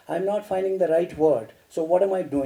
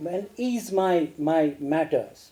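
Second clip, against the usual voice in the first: normal talking voice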